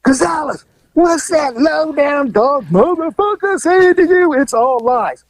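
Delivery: high, slow yelled accusation; angry neighbor shouting. Retold or imitated. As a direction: screech it across the street. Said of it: "here she goes again".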